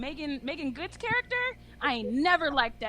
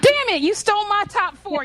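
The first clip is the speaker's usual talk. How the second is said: sudden loud frustrated outburst; 6 semitones higher, 10 dB louder than usual. Real, not acted. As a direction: shout it out, playfully annoyed. Cohost took his pick.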